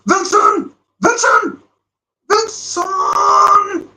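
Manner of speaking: frantic fan yelling the name; excited shouting. Real, not acted. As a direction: yell him on as he runs at goal. Striker through on goal.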